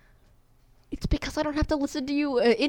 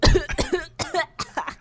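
mock gagging cough; 8 semitones higher, 3 dB louder than usual. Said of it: "He goes".

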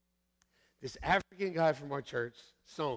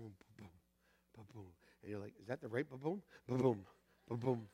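hushed heartbeat imitation; 8 semitones lower, 10 dB quieter than usual.

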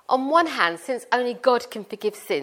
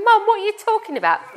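high, loud outraged son protesting to mum; indignant son; 11 semitones higher, 8 dB louder than usual. Retold or imitated. Mother voicing her hurt son protesting, high and loud. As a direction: play the wronged older son, exasperated and loud.

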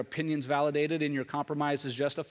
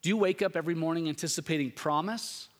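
ordinary delivery

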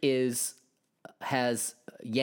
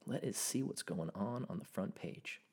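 mock low murmur; 5 semitones lower, 12 dB quieter than usual. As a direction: murmur it low like a playful aside. Repeats line in put-on voice.

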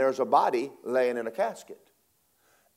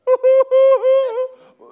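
loud high laugh; 18 dB louder than usual. Real, not acted. Preacher laughing at own story.

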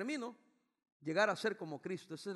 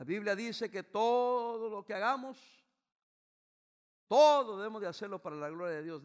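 emphatic preaching; 3 semitones higher, 10 dB louder than usual. Real, not acted. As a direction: drive the verse home.

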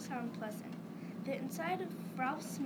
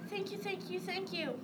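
high, rapid-fire grateful gush; mild excited scream, no extra volume; screamed thanks; 6 semitones higher than usual. A narrator's reading. Bob thanks his brother for the gift, screaming mildly. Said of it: "Then Bob screamed".

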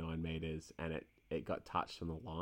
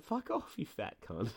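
high-pitched mock brush-off, then back down; mock insult; 9 semitones higher than usual. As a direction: yelp the first words, drop the insult. Retelling insult to the opponent.

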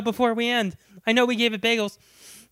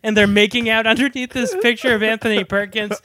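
normal talking voice

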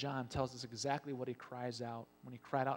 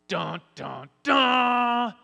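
ominous sung-out dun dun, rising to a shout; mock-dramatic doom fanfare; dramatic sound effect; 9 semitones higher, 15 dB louder, much slower than usual. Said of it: "dramatic sound effect before evaporating".

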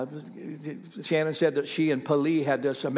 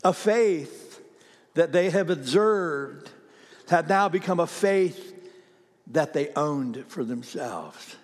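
slow, raised preaching cadence, settling at the end; emphatic preaching; 2 semitones higher, slower than usual. Real, not acted. Preacher telling the church about a faith they owned, slow and emphatic. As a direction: drive it home phrase by phrase. Preacher drives home the point.

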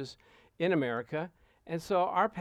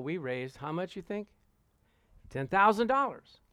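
normal talking voice

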